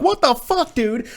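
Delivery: very high squealed disbelief; comic high-pitched outrage; shocked disbelief. Retold or imitated. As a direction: let the voice shoot way up, incredulous. Retold shocked reaction to Wesker running.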